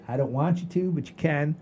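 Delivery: ordinary delivery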